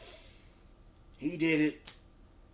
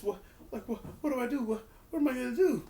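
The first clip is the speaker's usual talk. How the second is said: high, panicky mock fear, faster at the end; scared deer in headlights; 11 semitones higher, faster than usual. Wrestling fan mimics scared Roman in high panicked voice. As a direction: play Roman frantic and lost, voice climbing. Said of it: "legit scared and was looking like a deer in headlights".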